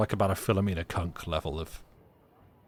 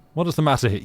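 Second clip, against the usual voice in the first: higher, louder acted question; concerned intervener; 6 semitones higher, 6 dB louder, faster than usual. A narrator's reading. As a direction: step in and demand an explanation.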